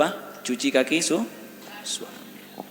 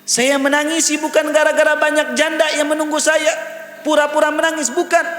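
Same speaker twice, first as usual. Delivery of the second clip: high, emphatic retold protest; preacher's high, forceful quotation; impassioned preaching; 12 semitones higher, 5 dB louder than usual. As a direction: declaim the imam's denial in a raised voice.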